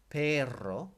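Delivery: "perro" with a loud, rolled double R. Shown as a demonstration. Teacher showing learners the word for dog, "perro" with rolled R. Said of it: "it's got two R's in it".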